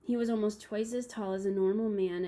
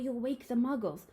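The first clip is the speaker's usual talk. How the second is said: hissed warning; 4 semitones higher than usual. A narrator's reading. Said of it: "hissed Professor McGonagall".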